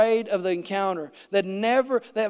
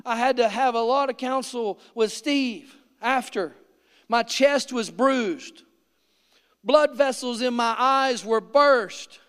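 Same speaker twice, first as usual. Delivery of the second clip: ordinary delivery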